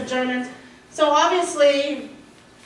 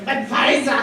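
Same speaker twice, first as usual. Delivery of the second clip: mock German accent; 4 dB louder than usual. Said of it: "in the car, I'm going".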